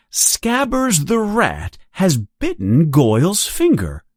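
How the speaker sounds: usual speaking voice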